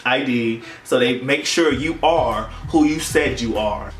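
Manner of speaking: normal talking voice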